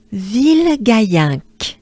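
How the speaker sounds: Ville Gaillinck slow, nasal French ending. Shown as a demonstration. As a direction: say Ville Gaillinck deliberately for learners. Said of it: "Let me break it down".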